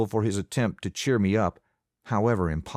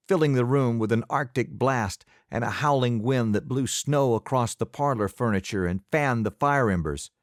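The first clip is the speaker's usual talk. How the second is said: ordinary delivery, nothing marked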